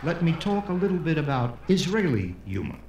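usual speaking voice

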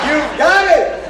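high, loud joyful cheer; excited. Acted. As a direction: cheer the student on. Teacher cheering the student.

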